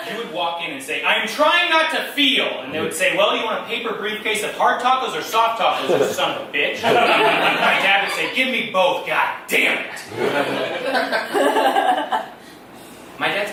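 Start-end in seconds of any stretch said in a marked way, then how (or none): 1.0-2.5 high, loud, slow mock wail; anguished customer. Customer walking into a sad Taco Bell, loud anguish. 3.2-6.8 loud, higher-pitched mock-hostile order taking; gruff cashier. Cashier asking dad for his order, loud and hostile. Acts out rude Taco Bell cashier. 8.3-10.0 loud, raised, cursing order; angry dad. Retold or imitated. Dad ordering at Taco Bell, loud and angry.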